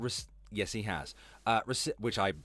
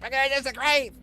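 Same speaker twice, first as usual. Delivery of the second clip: high, loud mimicry of a prim objection; mocking echo; 7 semitones higher, 5 dB louder, faster than usual. Streamer parroting the woman who will disagree firmly, mocking.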